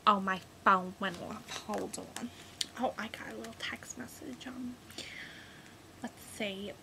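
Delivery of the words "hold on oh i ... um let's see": whispered, much softer; muttering to self. Real, not acted. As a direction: read it to yourself under your breath. Reading a text on phone.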